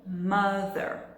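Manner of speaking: slow, careful TH in "mother"; TH sound. Shown as a demonstration. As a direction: model "mother" slowly, tongue to teeth on the TH. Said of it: "Aprenda o som do T H".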